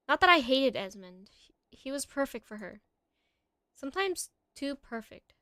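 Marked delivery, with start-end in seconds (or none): none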